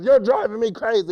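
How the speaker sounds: high, loud exasperated cry; upset hunter. Acted. Hunter driven off by the copycat, high loud upset. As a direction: cry out at the end of your rope. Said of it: "gets so upset".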